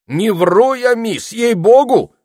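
high, loud indignant denial; vehement, high-pitched swearing of honesty; loud protest. A narrator's reading. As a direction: protest your innocence at the top of your voice. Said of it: "запротестовал Этельред".

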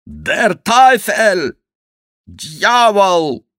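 very high, loud, drawn-out oath; shouted curse. A narrator's reading. Blue-dyed Schomberg sees himself, shouting curses. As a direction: bellow the curse slowly at the top of your range. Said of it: "закричал он".

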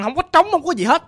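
plain reading voice

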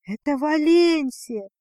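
slow, ringing outcry; excited cry. A narrator's reading. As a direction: cry it out in happy recognition. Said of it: "выкрикнула Лузи".

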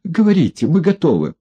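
high, ingratiating offer; fawning. A narrator's reading. Одутловатый заискивающе предлагает Егорову услуги. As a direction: fawn over him eagerly. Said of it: "добавил заискивающе".

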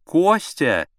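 high woman's call from another room. A narrator's reading. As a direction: call for your husband from the bedroom. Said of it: "донёсся из спальни голос Аллы Сергеевны".

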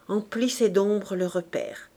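ordinary delivery, nothing marked

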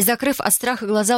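usual speaking voice

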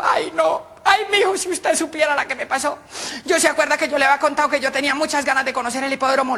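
put-on high, whiny old lady voice; a suegra telling her son-in-law, high and plaintive; mother-in-law. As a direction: wail it like a battered suegra. Mother-in-law tells her tale.

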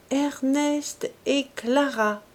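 a woman deciphering scrawled signatures, slow and careful; spelling out slowly. A narrator's reading. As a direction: read the names haltingly, with effort. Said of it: "Elle épelait les syllabes avec quelque difficulté".

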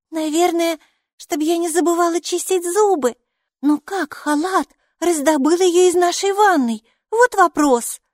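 put-on high, loud little-girl voice; wondering child. A narrator's reading. Майки wonders about the toothbrush, high child voice.

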